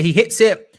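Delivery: high, loud burst as the shot comes; excited commentary. Real, not acted. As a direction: call the shot with rising excitement. Shot during live play.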